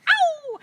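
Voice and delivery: loud, high "Ow!"; pained yelp. Acted. Robber butted by the cow, high pained yelp.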